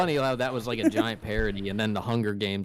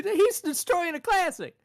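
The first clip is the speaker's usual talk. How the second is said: much higher comic booing voice; mock booing; 3 dB louder, slower than usual. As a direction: boo like the comedian. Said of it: "when he was booing the dude".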